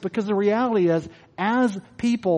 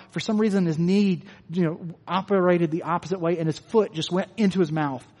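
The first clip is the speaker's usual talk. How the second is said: ordinary delivery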